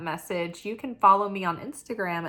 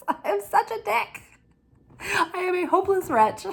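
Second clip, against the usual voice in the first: higher, laughing self-mockery; a real giggling confession, pitched up; laughing at herself; 10 semitones higher than usual. Real, not acted. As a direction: laugh it out at your own expense. Laughing at her own impatience.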